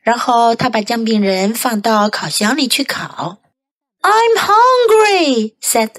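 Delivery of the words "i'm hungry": high, drawn-out complaint; old man character. A narrator's reading. Hungry old man to his wife, high drawn-out voice. Old man's line in story.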